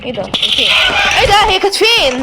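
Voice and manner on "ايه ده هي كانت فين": sudden loud high alarm; where did she go, startled shout. Real, not acted. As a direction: jolt up in real shock. Ghost suddenly vanishes in game.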